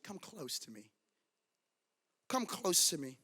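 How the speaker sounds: soft, low, gentle invitation; sweet, tender. Acted. Joseph inviting his brothers nearer, sweet and tender. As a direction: draw them in softly, voice dropped low. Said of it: "something so sweet".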